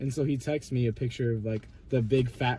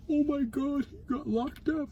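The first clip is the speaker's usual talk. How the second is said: mock alarm; 13 semitones higher, slower than usual. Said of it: "and he's like".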